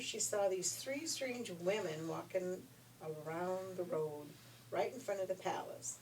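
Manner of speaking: plain reading voice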